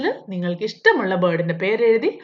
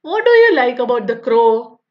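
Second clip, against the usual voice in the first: slow, careful reading of the question; textbook read-aloud; 6 dB louder, much slower than usual. Acted. Teacher reading the workbook question to pupils, slow and deliberate. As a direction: read each word out slowly for young pupils.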